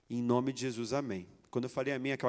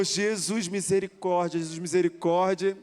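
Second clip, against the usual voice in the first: loud, raised cry for mercy; retold desperate plea; 5 semitones higher, 7 dB louder, slower than usual. Husband pleading for mercy after his wife's outburst, loudly. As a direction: call out to heaven, higher and louder.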